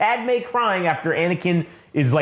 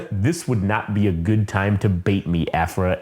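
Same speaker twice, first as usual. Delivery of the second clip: Vader warning; 7 semitones lower than usual. Retold or imitated. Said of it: "he says".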